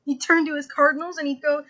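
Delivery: ordinary talk, nothing marked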